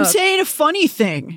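high, dopey mock voice; mocking a prankster who thinks he's funny, high and dopey; dumb prankster voice. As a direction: sound dim and proud of it.

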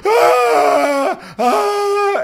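loud, very high screams; hammed-up scream. Man losing it at a crab on his chin, mock scream. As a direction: shriek in mock terror, twice. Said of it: "the guy's screaming".

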